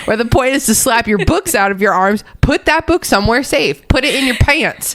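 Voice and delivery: talking through laughter; laughing while giving advice. Real, not acted.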